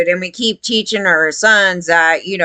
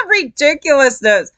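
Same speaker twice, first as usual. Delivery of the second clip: normal talking voice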